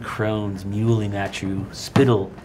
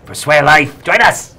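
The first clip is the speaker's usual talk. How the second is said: rising into a loud, high shriek; many snarling voices overlapping; 3 semitones higher, 8 dB louder than usual. Acted. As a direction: monster crones screaming the call. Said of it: "the three heads say in unison".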